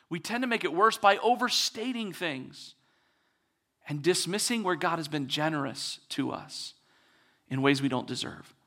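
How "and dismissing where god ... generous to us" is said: usual speaking voice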